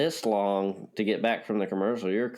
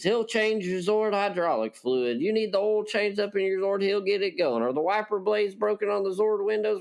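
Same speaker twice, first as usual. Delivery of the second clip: high, fast-talking small-town repair shop pitchman; hokey local car-shop ad; 8 semitones higher than usual. Pretend. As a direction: sell the Zord repair like a cheesy TV ad. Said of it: "Apparently, I can make commercials".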